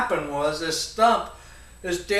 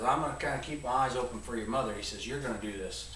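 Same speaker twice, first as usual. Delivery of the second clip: hushed conspiracy; 7 semitones lower, 9 dB quieter, faster than usual. Retold or imitated. Dad hides plan from mom.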